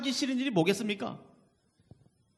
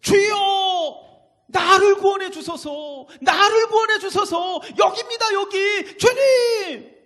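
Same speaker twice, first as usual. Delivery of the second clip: desperate high scream for rescue; 베드로; 13 semitones higher, 13 dB louder, slower than usual. Acted. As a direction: yell at full force, drawing out the words. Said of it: "이렇게 소리 지르지 않았겠습니까". (물에 빠져 다급하게 절규하듯)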